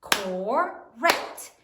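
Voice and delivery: "Correct" split into two slow beats; syllable by syllable. Shown as a demonstration. Teacher counts syllables for the class, "Correct" in two separate beats. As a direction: separate "Correct" into cor, rect with a gap. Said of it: "How many syllables are there".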